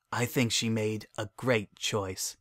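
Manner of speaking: soft, slow and tender; tender aside. A narrator's reading. Shining tells his mare friend softly, tender. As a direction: say it gently over the sleeping filly.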